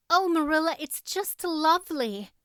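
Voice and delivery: put-on high, bright girlish delight; delighted gasp. A narrator's reading. Anne thanks Marilla for the new dress, delighted. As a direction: gush with thanks over a gift. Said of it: "said Anne".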